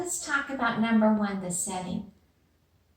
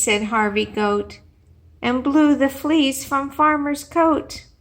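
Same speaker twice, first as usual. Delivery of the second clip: put-on bright, louder reading-to-children voice; a teacher performing a rhyming picture book; storybook read-aloud; 9 dB louder than usual. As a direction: read it out big and playful for the class.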